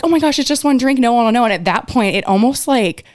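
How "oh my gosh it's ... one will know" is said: mock peer pressure. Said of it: "they were all like".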